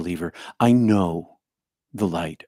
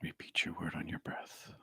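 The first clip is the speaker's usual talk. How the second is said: whispered, much softer prayer cue; hushed prayer guidance; 2 semitones lower, 20 dB quieter than usual. Acted. Leader guiding the group into silent prayer, whispered. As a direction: breathe the words out to a still room.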